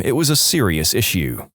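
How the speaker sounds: plain reading voice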